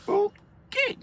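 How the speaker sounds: very high, mock-meek squeak; squeaky okay. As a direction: squeak it out like a cowed kid. His meek reply to mom.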